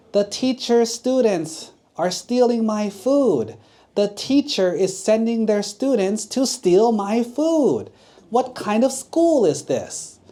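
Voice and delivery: high, loud, slow accusing yell; old lady yelling. Acted. Old lady yelling at the teacher, high and loud. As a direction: shout it out, scandalised. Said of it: "the old lady started yelling".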